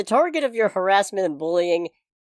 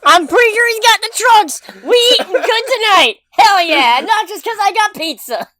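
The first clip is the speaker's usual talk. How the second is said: excited; 14 semitones higher, 8 dB louder than usual. Real, not acted. Delighted spotting Superman's trunks.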